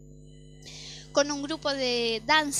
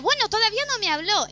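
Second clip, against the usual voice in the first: put-on a touch higher and louder, quick, childlike; young self answering dad; 7 semitones higher, 6 dB louder, faster than usual. Little girl telling her dad, mildly childlike. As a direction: replay her eight-year-old self, a bit fast.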